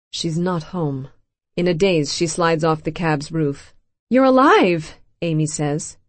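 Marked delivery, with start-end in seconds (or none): 4.1-5.0 loud, high joyful cry; overjoyed. A narrator's reading. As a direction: burst out with disbelieving joy. Amy sees dead friend alive.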